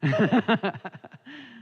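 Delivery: bright burst of laughter. Real, not acted. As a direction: laugh out loud at the joke. Laughing at Dan's joke.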